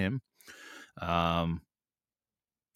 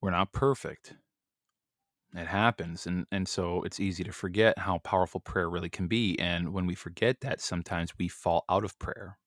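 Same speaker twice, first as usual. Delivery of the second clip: ordinary talk, nothing marked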